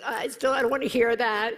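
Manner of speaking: high, loud irritated protest; annoyed refusal. Retold or imitated. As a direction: brush off his story. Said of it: "and I was like".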